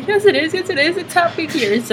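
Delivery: high, half-crying reassurance; teary reassuring. Retold or imitated. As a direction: comfort her through happy tears. Reassuring the child through tears. (tröstande, med gråten i halsen)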